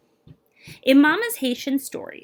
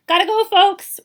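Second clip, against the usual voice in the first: loud, higher, brisk call to wrap it up; guard's loud call; 9 semitones higher, 10 dB louder than usual. Acted. Guard telling the family visiting time is over, loud call. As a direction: announce it's time to leave, loud and high.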